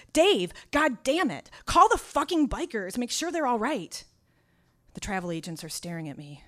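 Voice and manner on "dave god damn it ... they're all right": a loud, high, angry phone yell. Retold or imitated. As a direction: yell at him to call them. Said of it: "I yell into the phone".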